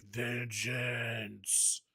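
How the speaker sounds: drawn-out, slowly stretched mock-hero growl; mock Batman voice. Fan quoting Batman's answer to friends, hammed-up impression. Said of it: "You gotta say it in Pattinson's voice".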